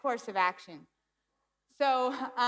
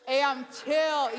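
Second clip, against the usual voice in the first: loud, high, drawn-out cool act; mock carefree; 5 semitones higher, 8 dB louder, slower than usual. Patient leaving the doctor's office, loud hammed-up carefree swagger. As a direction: stretch it out, showing off your relief.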